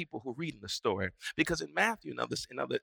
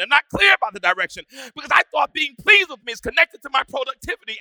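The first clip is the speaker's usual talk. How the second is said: loud, rising preacher's cry; full-force preaching; 9 semitones higher, 15 dB louder than usual. Acted. Pastor preaching loudly to his congregation.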